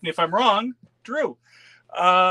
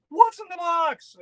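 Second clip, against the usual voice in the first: mock curious; 12 semitones higher than usual. Real, not acted. Opening a mystery box.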